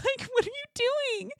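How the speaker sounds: high, baffled questioning; mock incredulous. Reacting to her hiding in the bushes, high incredulity. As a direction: squeak it in laughing disbelief.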